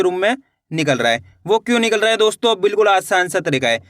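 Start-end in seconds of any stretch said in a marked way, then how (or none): none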